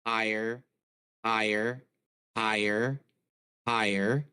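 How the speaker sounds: gain rising from very low; uneven auto gain. Shown as a demonstration. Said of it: "now I'm low, higher".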